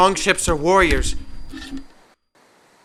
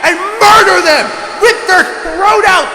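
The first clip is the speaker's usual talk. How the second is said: bloodthirsty war cry; shouting; 8 semitones higher, 6 dB louder than usual. Acted. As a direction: roar it out for blood.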